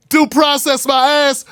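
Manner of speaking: high, slow dismissive scoff. Retold or imitated. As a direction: sneer it out slowly. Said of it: "Can you imagine the blowback?".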